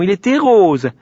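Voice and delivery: put-on high, loud little-girl correction; indignant. A narrator's reading. Malika corrects the pig's colour, indignant. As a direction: insist on it, outraged. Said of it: "dit Malika avec indignation".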